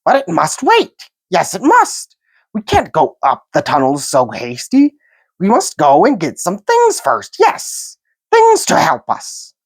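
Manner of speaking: high, sly creature excuse; sly Gollum voice. A narrator's reading. Gollum making an excuse to leave Bilbo. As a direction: stall slyly, hissing.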